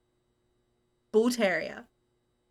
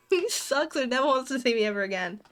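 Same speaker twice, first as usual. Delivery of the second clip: ordinary talk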